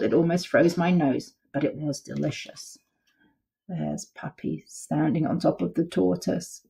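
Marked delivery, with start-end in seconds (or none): none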